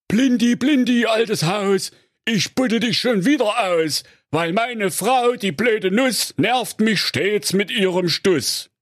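high, sing-song rhyme to the bomb; husband reciting rhyme. A narrator's reading. Digger driver calling the dud bomb, high sing-song rhyme. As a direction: chant the little summoning verse.

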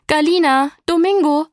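loud, high call up the stairs; aunt calls the twins from downstairs, loud and high. Acted. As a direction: shout their names from below. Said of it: "hörten sie ihre Tante von unten rufen".

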